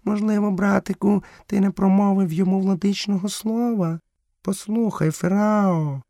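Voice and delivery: very high panther voice; measured, high-pitched feline suggestion; panther character, modest. A narrator's reading. Panther suggests little brother forgot the Master-word, high voice.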